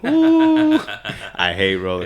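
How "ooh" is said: sudden high-pitched ooh; high fearful ooh. Retold or imitated. Rider showing how heights get to him, high ooh. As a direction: let out a high wavering ooh.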